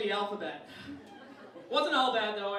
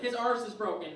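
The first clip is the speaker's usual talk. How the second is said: Irish dad quote at normal volume; toned-down Irish dad. Retold or imitated. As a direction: repeat dad's line without shouting. Comedian repeats dad's opening line.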